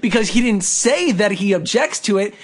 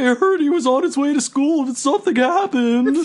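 lightly voiced cartoon turtle, higher; a hint of a goofy turtle; 4 semitones higher than usual. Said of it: "cartoon turtle voice".

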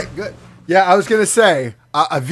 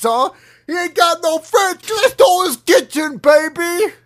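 put-on New Jersey accent; 4 semitones higher than usual. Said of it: "while also doing a New Jersey accent".

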